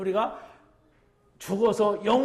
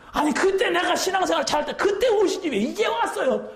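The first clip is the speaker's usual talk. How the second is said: much higher, whining, half-laughing grumble; whiny complaint; 10 semitones higher than usual. Retold or imitated. Unready believer grumbling at the Lord's return, high whiny voice. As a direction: whine at the Lord like a sulking child.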